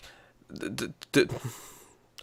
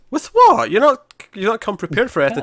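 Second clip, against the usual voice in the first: high, loud mock disbelief; retold incredulity; 9 semitones higher, 4 dB louder than usual. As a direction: challenge the plan in raised-pitch disbelief. Retold incredulous reaction to the plot.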